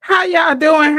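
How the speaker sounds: big excited hello; loud greeting. Real, not acted. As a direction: call out the welcome. Loud live-stream greeting.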